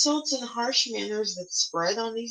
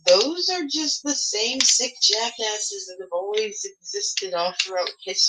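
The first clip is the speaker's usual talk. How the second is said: disgusted outburst; 6 semitones higher, 5 dB louder than usual. Real, not acted. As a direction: spit out the contempt. Angry outburst at extremists.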